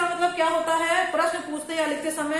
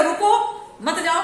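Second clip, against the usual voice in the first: loud रुको, then मत जाओ; slow, emphatic stop on रुको; pause after रुको; 3 semitones higher, 5 dB louder, slower than usual. Shown as a demonstration. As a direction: punch रुको and break before मत जाओ. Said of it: "मतलब तुम आज रुक जाओ".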